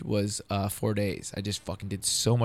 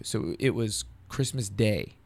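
normal talking voice

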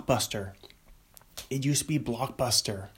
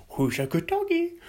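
put-on high baby-talk to dog. Shown as a demonstration. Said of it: "repeated plain".

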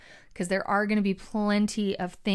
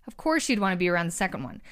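usual speaking voice

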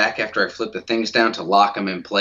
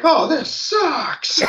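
put-on high, slow disappointed kid; whiny kid voice; much slower than usual. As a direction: drag it out like a let-down child. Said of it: "he's like".

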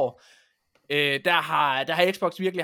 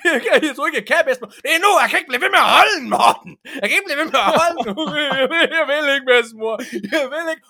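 put-on high, loud, fast wailing child half laughing; boy begging grandmother, mock wailing and laughing; whiny crying boy; 9 semitones higher, 10 dB louder, faster than usual. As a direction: whimper and plead like a scared little boy. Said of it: "jeg skreg og græd samtidig".

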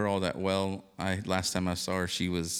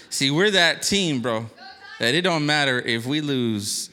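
hyped up; 7 semitones higher, 9 dB louder than usual. Real, not acted. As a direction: preach it like a pep rally. Hyped preaching about team.

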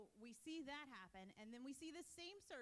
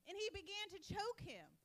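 forceful reading; 6 semitones higher, 6 dB louder than usual. Acted. Reading violent scripture scene.

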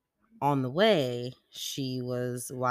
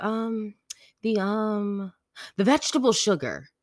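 higher-pitched imitation of a hesitant child; halting kid voice, landing louder on the answer; kid searching for words; 9 semitones higher, 6 dB louder than usual. Daughter inventing a name for salt, hesitant then emphatic.

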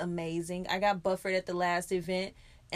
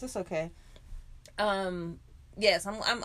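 usual speaking voice

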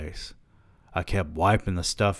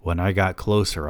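ordinary delivery, nothing marked

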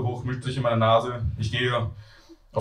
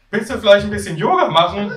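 high, raised character voice; high-voiced flatmate; 9 semitones higher, 5 dB louder than usual. Flatmate offering yoga to a queasy newcomer, high voice.

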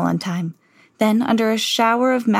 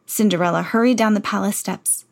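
usual speaking voice

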